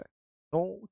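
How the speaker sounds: soft squeaky little fish voice pleading no; fish saying no. Pretend. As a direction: play a tiny fish refusing, softly. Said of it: "वो क्या बोलती है?". (मछली की धीमी, नखरीली ना)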